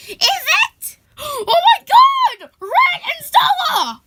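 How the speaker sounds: high, loud cry of disbelief; overjoyed recognition. Acted. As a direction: exclaim in amazement at finding lost friends. Reunion with lost friends.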